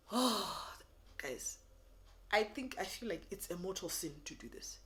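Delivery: ordinary delivery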